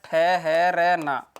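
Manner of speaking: "ठहरना" very slow, each syllable stretched apart; drawn-out syllables. Shown as a demonstration. Teacher giving another meaning of विराम, "ठहरना" drawn out slowly.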